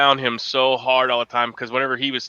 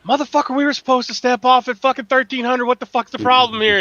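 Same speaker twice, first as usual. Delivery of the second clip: high, loud angry ranting; retold angry outburst, pitched up high; aggravated rant; 11 semitones higher, 3 dB louder than usual. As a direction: blow up at the late step-off. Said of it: "when I'm aggravated".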